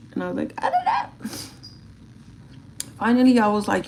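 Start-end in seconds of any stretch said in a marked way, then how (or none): 0.6-1.3 squeaky little helpless voice; squeaky whine. Retold or imitated. Answering her staring cat, squeaky helpless whine. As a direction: pitch way up, tiny and helpless.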